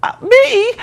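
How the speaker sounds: imitating his own innocent surprise; mock innocence. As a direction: play dumb, who me. Playing innocent when warned.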